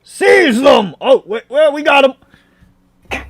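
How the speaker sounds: high, loud shouted order; guard shouting. Acted. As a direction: bellow the command at the prisoners. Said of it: "another cry of".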